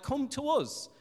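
mock people summoning God over; acted demand. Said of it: "and we say".

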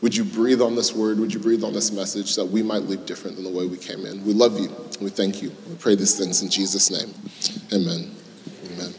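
soft, hushed prayer voice; opening prayer. Acted. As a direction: pray quietly and earnestly to God. Pastor leads an opening prayer.

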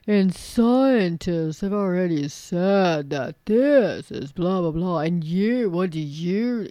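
imitating a smug know-it-all, words drawn out. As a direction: mockingly stretch it into a condescending drone. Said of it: "They have the mightier than hour".

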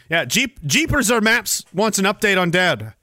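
normal talking voice

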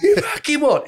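high, barking mock outrage with a laugh; hammed-up fan snapping back; aggro football fan. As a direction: play the offended terrace bloke. Repeats aggressive fan quote.

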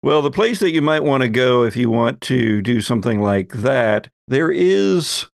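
ordinary talk, nothing marked

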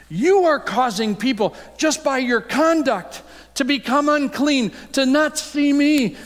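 high, loud impassioned rebuke; Jesus rebuking. Acted. Jesus confronting the Pharisees, loud and high. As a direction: cry out the accusation at them.

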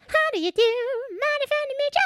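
squeaky sped-up cartoon chipmunk. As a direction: pitch it way up like a chipmunk. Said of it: "But in the Chipmunk voice".

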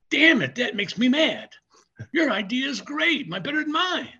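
grudging admission, retold with punch; exasperated colleague. Colleague hears the plan laid out, mock exasperated admiration. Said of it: "Eric went".

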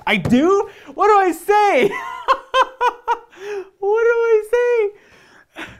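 high, loud squeal of shocked disbelief, breaking into laughter; real astonished shriek that melts into a laugh; surprised disbelief. Real, not acted. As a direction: genuinely caught off guard and yelping the question. Viewer says he uses French.

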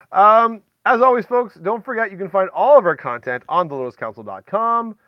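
outro plug. Real, not acted. Host's outro plug, much louder.

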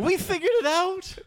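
squealing high with glee; excited. Real, not acted. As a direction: shout the discovery in delight. Excited discovery.

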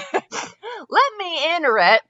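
impatient. Acted. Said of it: "Scarlett said impatiently".

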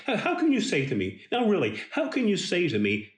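how, how can you say to me, in rising disbelief; incredulous outburst. Acted. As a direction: say it as if you can't believe your ears. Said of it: "[name] seems incredulous at what".

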